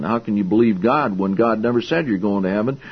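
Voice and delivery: ordinary talk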